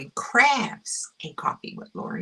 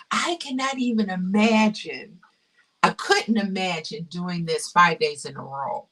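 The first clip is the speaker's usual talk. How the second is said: normal talking voice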